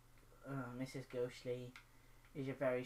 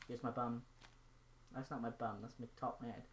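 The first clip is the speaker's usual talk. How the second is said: ordinary delivery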